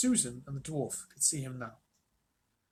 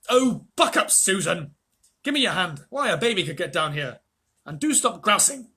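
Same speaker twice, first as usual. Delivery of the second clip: loud, impatient calling out; 4 semitones higher, 10 dB louder than usual. A narrator's reading. Peter calls down the cliff to Susan, loud impatient urging. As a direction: chivvy a grumbling sister along. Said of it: "Peter's voice saying".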